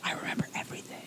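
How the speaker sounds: hushed, breathless whisper. Retold or imitated. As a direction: whisper it like a dazed patient. Retold waking up after sedation.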